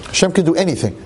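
ordinary talk

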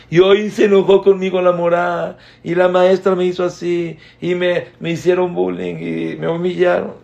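put-on high child's voice, loud, complaining; upset child. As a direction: tell on the teacher like a hurt little kid.

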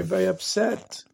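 usual speaking voice

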